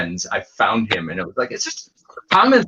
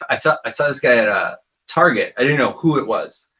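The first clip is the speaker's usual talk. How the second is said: normal talking voice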